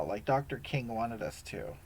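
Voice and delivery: ordinary delivery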